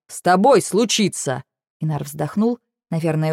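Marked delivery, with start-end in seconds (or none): 0.1-1.4 slow, pointed contradiction; emphatic retort. A narrator's reading. Dragon contradicting her promise, emphatic retort. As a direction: stress ТОБОЙ, drawn out and exasperated.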